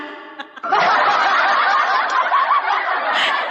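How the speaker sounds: airy laugh up high; high breathy laugh. Real, not acted. Woman laughing about her crooked teeth, high airy laugh.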